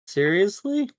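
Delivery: disbelieving. Real, not acted. Bad roll outcome.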